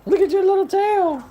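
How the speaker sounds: very high, loud coo. Real, not acted. Owner cooing over her dog's little tail, high baby talk. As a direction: squeal sweetly to a pet.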